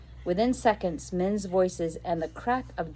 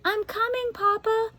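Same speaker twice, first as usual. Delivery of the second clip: high shout back towards the house; a daughter shouting back to her father; 3 dB louder than usual. Acted. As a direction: call out so he hears. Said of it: "she shouted without moving".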